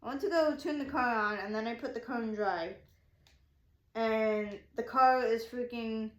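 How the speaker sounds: normal talking voice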